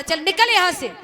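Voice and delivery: loud, high command to get out; angry dismissal. Acted. Son shoving his mother away with a loud order to leave. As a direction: bark her out of the house.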